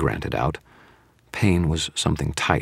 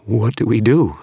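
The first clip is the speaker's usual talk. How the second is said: frightened, higher and louder; shaking voice; 7 semitones higher, 6 dB louder than usual. Acted. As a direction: ask in rising panic. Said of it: "His voice was shaking".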